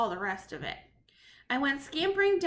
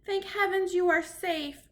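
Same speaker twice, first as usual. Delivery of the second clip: relieved; 6 semitones higher, 3 dB louder than usual. Acted. Grandmother relieved.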